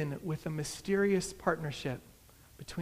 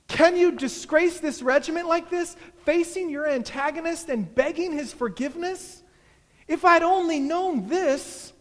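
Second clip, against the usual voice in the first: high, loud outraged scolding; shouting; 10 semitones higher, 9 dB louder than usual. A narrator's reading. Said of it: "were shouting too".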